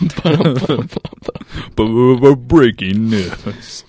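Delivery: loud, slow stuttered news announcement; news anchor voice. Pretend. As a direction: announce it like a breaking bulletin.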